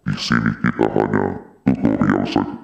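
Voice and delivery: deep processed monster voice; giant voice. Acted. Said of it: "hasil suara kayak suara raksasa".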